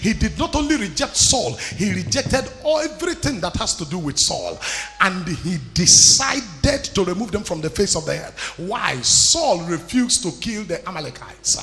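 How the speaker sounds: loud, forceful sermon delivery; impassioned preaching. Real, not acted. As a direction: preach it boldly to the congregation. Preaching to congregation with rising force.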